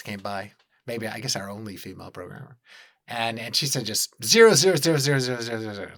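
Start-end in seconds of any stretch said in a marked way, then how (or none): 4.2-5.9 rattled-off imitation. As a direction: rattle the word off like a machine-gun burst. Imitates programmer reciting a string of zeros.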